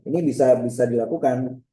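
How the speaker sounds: ordinary delivery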